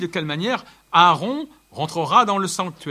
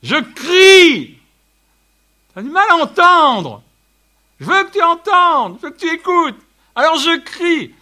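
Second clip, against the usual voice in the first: loud, high cry as God calling out; 13 semitones higher, 14 dB louder than usual. Acted. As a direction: shout it like God calling Moses. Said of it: "c'est Dieu crie". (Dieu qui crie, voix forte et haute)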